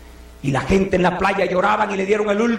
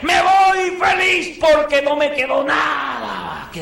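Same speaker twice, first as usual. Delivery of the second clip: higher, near-shouting testimony; fervent declaration; 6 semitones higher than usual. Retold or imitated. Paul declaring to the brothers he kept nothing back, near shouting. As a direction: proclaim it with rising force.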